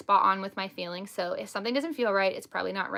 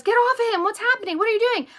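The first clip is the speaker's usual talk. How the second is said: high, raised-voice urgent questions; alarmed demand; 11 semitones higher, 4 dB louder, faster than usual. Retold or imitated. Husband walks in on the tantrum, alarmed demand. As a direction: act out bursting in and demanding answers.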